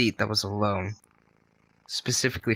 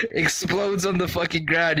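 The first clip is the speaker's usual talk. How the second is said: ordinary talk, nothing marked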